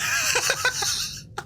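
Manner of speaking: breathy high giggle burst; high airy giggle. Real, not acted. Laughing at her own VTuber joke, high airy giggle.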